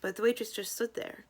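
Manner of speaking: usual speaking voice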